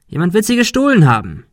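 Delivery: high, loud insistence; heated protest. A narrator's reading. Ben defends himself to Monika, high and heated. Quarrel over the lost wallet.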